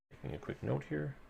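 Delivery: ordinary talk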